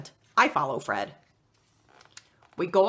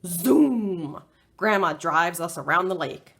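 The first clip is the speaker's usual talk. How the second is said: usual speaking voice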